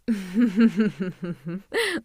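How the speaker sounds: loud, drawn-out gloating laugh; villainous laugh. Acted. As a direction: laugh in triumph over a captive. Villain gloats over captive hero.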